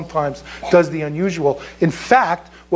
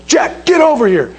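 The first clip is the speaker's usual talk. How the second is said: raised, higher-pitched drill sergeant call; retold drill sergeant yell; 7 semitones higher, 4 dB louder than usual. Drill sergeant summons the recruit across the chow hall, yelling. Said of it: "he yells and he said".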